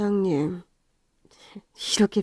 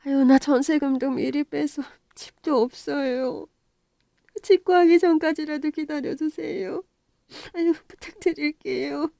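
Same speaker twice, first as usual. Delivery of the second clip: pleading; 6 semitones higher than usual. Acted. Old tenant begs landlord to wait.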